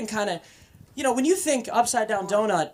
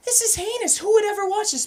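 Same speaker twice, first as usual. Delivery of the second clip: high, disgusted squeal of regret; hammed-up high-pitched disgust; mock disgust; 14 semitones higher, 5 dB louder than usual. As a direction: shriek it like a scandalised prude.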